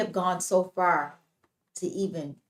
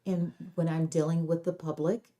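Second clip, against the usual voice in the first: softer, controlled public-facing tone; professional voice; 4 dB quieter than usual. Shown as a demonstration. Host dealing with the public, professional voice. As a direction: speak softly in careful standard diction. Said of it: "I can talk like this".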